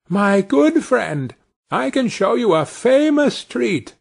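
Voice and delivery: put-on high, slow, coaxing little boy; tiny Tom Thumb voice. A narrator's reading. Tom calls out to the wolf inside, high and coaxing. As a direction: sweet-talk the wolf in a small high voice.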